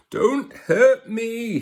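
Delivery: very high, slow whimpering plea; frightened whimper. Acted. Lion pleading with the mouse, high frightened whimper. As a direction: beg in a high scared squeak. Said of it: "he whimpered".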